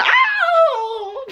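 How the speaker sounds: high playful yelp; giddy high "ow" squeal; mock squeal. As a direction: yelp like being swept up. Squeal of being lifted.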